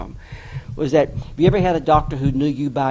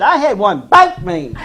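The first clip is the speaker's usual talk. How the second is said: high, loud, slow punchline; comic outrage; 11 semitones higher, 6 dB louder than usual. Retold or imitated. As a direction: top the question with drawn-out outrage. Retelling midwife topping the question.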